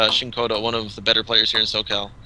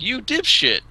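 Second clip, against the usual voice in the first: higher-pitched scornful rebuke; mock disbelief; 10 semitones higher, slower than usual. Retelling scolding a friend who apologized, mock scorn. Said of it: "looked at him like".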